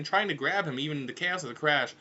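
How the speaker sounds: usual speaking voice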